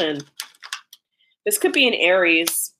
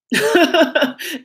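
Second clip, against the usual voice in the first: short laugh; 9 semitones higher, 4 dB louder than usual. Real, not acted. Laughs at person screwing up.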